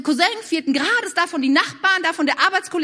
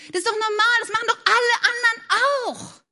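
higher, whiny shrug-it-off voice; mock justification; 8 semitones higher than usual. Preacher mimics a partygoer defending a joint, whiny and high. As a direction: plead that everyone does it.

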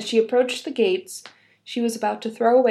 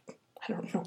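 unsure whispered aside; 3 semitones lower, 14 dB quieter than usual. Real, not acted.

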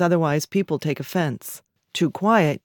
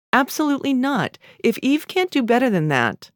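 high-pitched, exasperated businesswoman on the phone; affronted, no-nonsense phone voice; indignant refusal; 7 semitones higher than usual. A narrator's reading. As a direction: refuse outright, pitch jumping up. Daughter refusing firmly on the phone.

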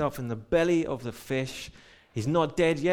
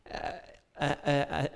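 ordinary delivery

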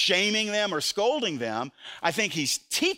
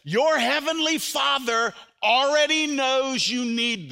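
tender reassurance; 8 semitones higher, 4 dB louder, slower than usual. Acted. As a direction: reassure gently and slowly. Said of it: "He says".

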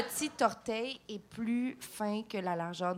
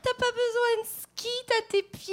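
high, teasing mother voice, almost tearful with laughter; imitating her mother. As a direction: mimic mom's mocking jab in a high voice. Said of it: "ma mère, elle a passé ma jeunesse à me dire comme". (imitation de sa mère, voix aiguë et moqueuse)

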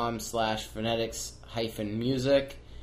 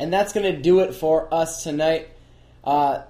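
announcer sign-off; 6 semitones higher, 8 dB louder than usual. Acted. Loud podcast sign-off.

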